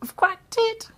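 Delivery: high, delighted inner cry of triumph; thrilled thought. Acted. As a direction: squeal it out like you've just won. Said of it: "I was thrilled to bits".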